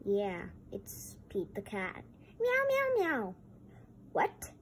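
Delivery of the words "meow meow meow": high, louder meowing; high playful meow; cat meows. Pretend. Pete the cat toy.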